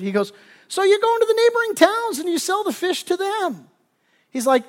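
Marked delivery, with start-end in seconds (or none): 0.7-3.7 very high-pitched, over-eager explaining; high, hammed-up enthusiasm; mock excited pitch. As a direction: act out an overexcited businessman selling his plan. Businessman pitching expansion, acted out.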